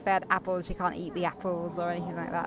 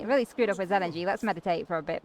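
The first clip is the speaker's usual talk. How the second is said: ordinary delivery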